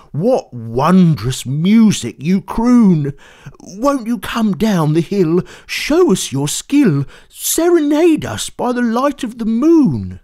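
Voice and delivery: woo the singer down the hill; eager plea. Acted. George begs the monster to serenade, loud and eager. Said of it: "he cried".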